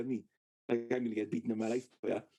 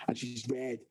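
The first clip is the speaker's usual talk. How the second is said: normal talking voice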